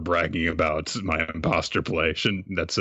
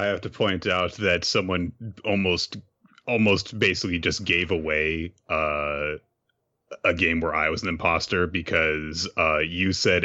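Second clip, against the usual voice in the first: ordinary talk, nothing marked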